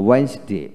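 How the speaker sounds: ওয়েডনেসডে as two-beat "wens-day"; silent first d. Shown as a demonstration. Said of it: "জ উচ্চারিত হবে". (প্রথম ডি ছাড়া ওয়েন্স-ডে)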